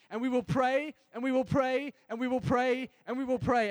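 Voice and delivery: loud, high, repeated chant; rallying cry. Acted.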